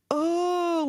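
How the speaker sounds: high, drawn-out exasperated "oh"; infuriated. Retold or imitated. Woman reacting to guys passing nudes around, infuriated. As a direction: let out exasperation on one long, high note. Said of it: "how much that infuriates me".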